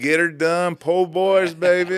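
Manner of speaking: high, loud Southern drawl; a high, loud Louisiana Southern voice; Southern accent. Retold or imitated. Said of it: "after two hundred years".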